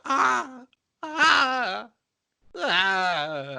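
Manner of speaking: high overacted whimpers; high whimpering. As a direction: fake whimpering cries. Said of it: "she was like".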